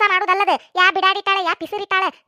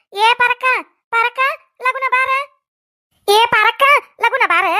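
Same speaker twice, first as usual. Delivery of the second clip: calling out; 5 semitones higher, 4 dB louder, slower than usual. Acted. Calling neighbour to come quickly.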